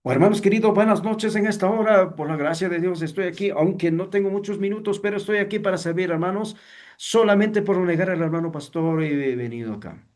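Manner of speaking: high, rapid, haughty preacher greeting; arrogant, haughty. Shown as a demonstration. Teacher mimics a preacher greeting the brethren, arrogant and haughty. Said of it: "Arrogante, altivo, inapropiado".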